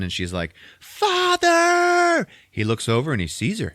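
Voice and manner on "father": high, loud scream of Father. Retold or imitated. Daughter screaming to her father across the lab, high and loud. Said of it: "decides to scream to Moss Mikkelsen".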